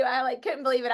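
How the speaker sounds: ordinary talk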